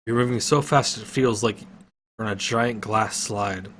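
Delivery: plain reading voice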